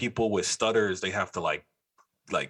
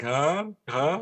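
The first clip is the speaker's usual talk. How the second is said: dazed stoner; 6 semitones higher than usual. Retold or imitated. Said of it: "your eyes is looking like".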